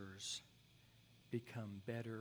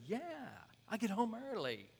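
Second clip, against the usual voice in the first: high, casual, unbothered; easygoing husband; 10 semitones higher, 4 dB louder than usual. Retold or imitated. Husband telling the counselor he's home early, high and casual. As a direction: say it like nothing's wrong. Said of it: "husband answering breezily".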